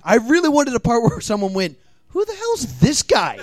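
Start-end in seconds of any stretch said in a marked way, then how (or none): 2.1-3.4 high-pitched comic disbelief; exasperated onlooker, pitched up; mock indignant. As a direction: challenge the stranger, hammed up. Acts someone questioning the hero.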